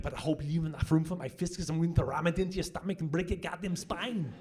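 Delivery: fast, tough action-hero threat; hammed-up action hero line; Schwarzenegger one-liner. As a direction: growl it like the Terminator. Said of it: "he busts out these huge lines like".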